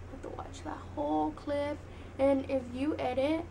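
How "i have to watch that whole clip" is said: whispered aside. Real, not acted. Aside while complaining about editing.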